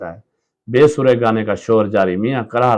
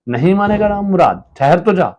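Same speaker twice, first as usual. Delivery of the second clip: high, raised scolding; angry scolding; 8 semitones higher, 4 dB louder than usual. Acted. Wife yelling at her son to stop, angrily. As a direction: yell at the stubborn child.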